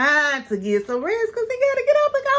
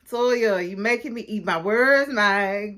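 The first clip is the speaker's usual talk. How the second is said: ordinary delivery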